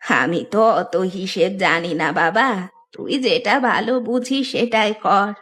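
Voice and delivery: aged granny voice, simple and resigned; trusting old woman. Acted. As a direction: speak as an old mother leaving it to him. Old woman defers to goldsmith. (বৃদ্ধার সরল গলা)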